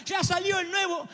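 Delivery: high, slow fake sobbing kid; hammed-up tantrum wail; mock crying child. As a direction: blubber it like a spoiled child. Child crying about iPhone.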